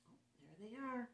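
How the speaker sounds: ordinary delivery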